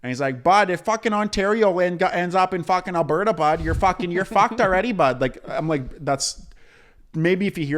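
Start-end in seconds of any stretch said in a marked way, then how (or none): 0.4-5.2 loud, higher hammed-up Canadian hockey bro; mock Canadian accent. Imitating a TikToker comparing provinces in Canadian accent. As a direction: lay on the Canadian accent thick, bud. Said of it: "I'd hear his voice".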